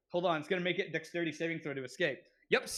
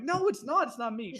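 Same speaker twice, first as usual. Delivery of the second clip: indignant denial; 7 semitones higher, 4 dB louder than usual. Retold or imitated. Denying being the alcoholic.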